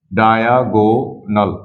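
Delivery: Diagonal drawn out slowly, syllable by syllable; slow sounding-out. Shown as a demonstration. Teacher introducing a new term to students, diagonal slowly sounded out. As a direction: stretch each part of diagonal.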